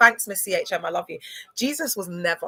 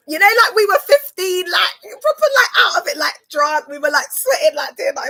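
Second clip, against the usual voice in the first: much higher and louder, shrieking with glee; excited shouting; 10 semitones higher, 8 dB louder than usual. Real, not acted. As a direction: relive the rave at full volume. Excitedly retelling drunk rave night.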